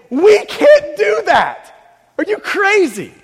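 loud, slow exasperated shout. Acted. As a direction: cry out in disbelief. Disciples voiced in exasperation.

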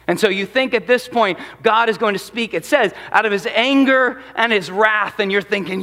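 ordinary talk, nothing marked

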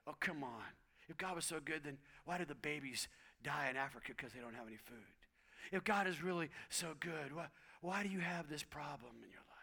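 soft, low, insinuating murmur; Satan's whisper. Acted. Satan whispering doubt in your ear, soft and low. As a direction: drop low and sow doubt. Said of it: "He wants to whisper".

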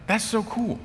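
high, soft mock awe; impressed. Newcomer reacting to teammates' kindness, high soft awe. Retold awed reaction to being shown grace.

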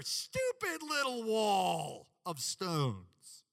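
"stupid" and "little" drawn out slow and heavy; stress on stupid and little. Acted. Pastor stressing Tobiah's insult, slow and sneering. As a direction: lean hard on "stupid little". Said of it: "I added some emphasis there, stupid and little".

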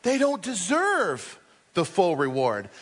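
ordinary talk, nothing marked

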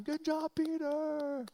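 high, drawn-out mock angelic cheer; cheering angels. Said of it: "you can hear the angels clapping".

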